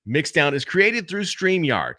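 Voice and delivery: matched announcer read, slow; slow promo read of the tagline; voiceover read. Shown as a demonstration. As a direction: read it like ad copy, matching the take before. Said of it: "I match myself with the inflection point".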